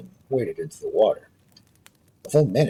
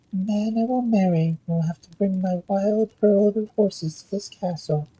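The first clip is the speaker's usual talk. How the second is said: a higher, lightly voiced princess; 9 semitones higher than usual. Princess telling the king her condition, lightly higher voice. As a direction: let her make a proud demand.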